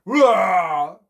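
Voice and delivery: loud, drawn-out roar; bear roar. A narrator's reading. Bear running home from the singing, roaring. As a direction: let out a big bear roar.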